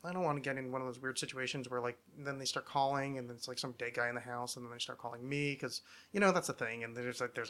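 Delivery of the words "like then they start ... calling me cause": ordinary talk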